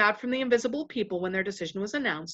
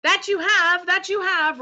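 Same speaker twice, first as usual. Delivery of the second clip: high, loud chorus of agreement; enthusiastic roar; 10 semitones higher, 7 dB louder than usual. A narrator's reading. Other voices back their chief, roaring enthusiasm. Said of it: "roared the other voices with great enthusiasm".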